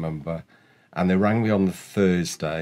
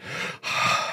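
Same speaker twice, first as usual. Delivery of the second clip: imitated winded exhale; out-of-breath sigh. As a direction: puff out as if worn out from pedalling. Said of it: "I'm out of breath now".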